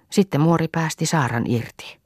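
ordinary delivery, nothing marked

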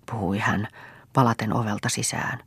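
ordinary delivery

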